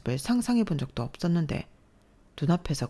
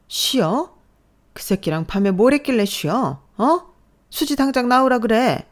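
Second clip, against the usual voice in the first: loud, higher, scornful yell; furious yelling; 7 semitones higher, 6 dB louder than usual. Acted. Mother-in-law yelling at daughter-in-law, furious. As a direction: fling the words back in rage.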